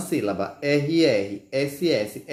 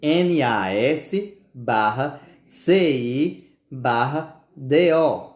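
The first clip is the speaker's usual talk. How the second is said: very slow, letter by letter with pauses; slow spelling; slower than usual. Shown as a demonstration. Teacher spelling nascido slowly for pupils. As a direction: spell each letter deliberately.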